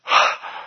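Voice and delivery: imitated sharp gasp of shock; sharp audible intake of breath; acted gasp. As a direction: gasp as if stunned by a miracle. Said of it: "And she goes".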